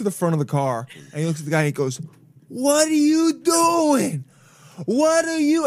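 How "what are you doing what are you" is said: put-on high, loud, drawn-out drunken shout; drunk yelling. Drunk man yells at driver blocking crosswalk, slow loud shout. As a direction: bellow it at the driver like a wasted street guy.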